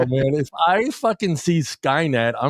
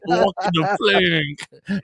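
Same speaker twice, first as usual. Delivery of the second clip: slow, higher mock pirate with Spanish lilt; pirate turned Spanish accent; 4 semitones higher, much slower than usual. Host playing French pirate, accent slipping into Spanish. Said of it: "I went Spanish right there".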